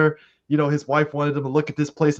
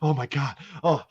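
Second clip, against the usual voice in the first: soft, hushed lovey-dovey murmur; mock tender reunion; 3 semitones lower, 8 dB quieter than usual. Husband hugging his returning wife, mock tender and soft. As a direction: melt into a hug, softly overdone. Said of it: "did you love the way he hugged".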